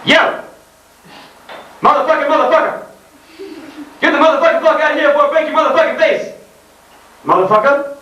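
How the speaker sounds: mock gangsta rant. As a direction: parody a rapper ranting at someone. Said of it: "And it goes like this".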